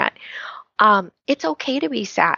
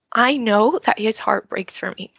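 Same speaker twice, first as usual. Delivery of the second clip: usual speaking voice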